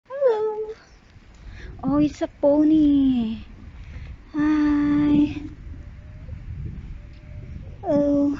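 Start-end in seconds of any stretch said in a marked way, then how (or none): none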